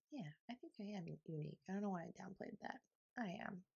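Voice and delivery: very soft, quick aside; quiet self-affirmation. Real, not acted. Podcaster admitting being unique, hushed aside. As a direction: drop right down, as if talking to yourself.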